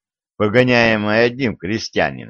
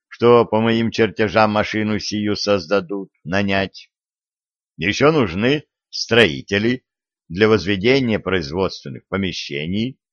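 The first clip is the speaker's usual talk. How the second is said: usual speaking voice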